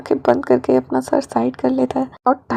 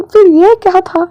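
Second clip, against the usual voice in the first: loud, high indignant demand; 6 semitones higher, 9 dB louder than usual. Acted. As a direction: throw the question back hard. Wing demands angrily. (गुस्से से ऊँची आवाज़ में सवाल)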